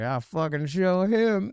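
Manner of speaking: high, slow defiant boast; high, slow, cocky drunk defiance. Retold or imitated. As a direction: spit out a stubborn vow. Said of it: "and I was like".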